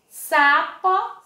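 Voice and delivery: teacher spells out the S in Sapo; stressed S sound. Shown as a demonstration. Teacher showing children the S sound, loud and clear. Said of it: "a professora [name] mostrando".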